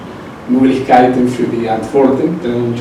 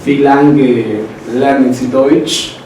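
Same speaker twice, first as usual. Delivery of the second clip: slow model phrase; much slower than usual. Shown as a demonstration. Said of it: "teacher models German phrases".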